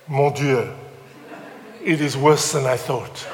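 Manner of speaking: mock French accent, spoken in short phrases. Frenchman reacting after ten minutes' silence, French accent. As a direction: deliver the punchline as a comic Frenchman, French accent. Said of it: "this is my French accent".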